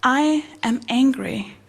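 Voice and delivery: slow, evenly spaced words; measured demonstration. Acted. Psychologist showing a client how to voice anger, measured. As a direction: say it slowly and deliberately, without anger. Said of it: "you just say the words".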